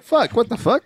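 high startled curse; startled yelp. Retold or imitated. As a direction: jump like a scared chicken. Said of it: "this guy's like".

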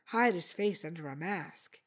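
ordinary delivery

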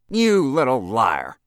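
slow, very loud, high accusation; angry interruption. A narrator's reading. Lobbyist breaks in on the boy, furious. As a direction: roar it over the boy's words. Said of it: "broke in Ludlow".

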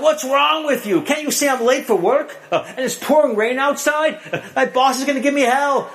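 hammed-up husband shouting at his wife; retold angry yell. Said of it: "He yells out".

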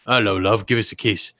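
low Cockney leer; sleazy Cockney voice; Cockney creep. Acted. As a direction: drop the pitch into a creepy Cockney come-on.

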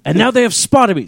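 mock British wildlife narration; British nature narrator. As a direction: narrate your own discovery like a British documentary host. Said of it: "Are you narrating us again?".

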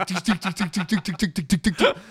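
high, rapid-fire "tick" sounds; imitated paintball fire. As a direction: rattle off mock gunshots in an even string. Imitating paintball gun firing.

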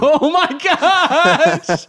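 high, slow, drawn-out laughing gasp; real laughter, high and stretched; laughing disbelief. Real, not acted. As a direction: crack up at the creepy twist. Reacts to creepy story.

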